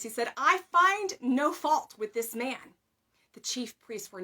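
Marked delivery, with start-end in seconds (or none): 0.4-2.7 loud, slow, ringing proclamation. A narrator's reading. Governor addressing the impatient crowd, loud and ringing. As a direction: Pilate rings out his verdict to the crowd. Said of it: "In a ringing voice".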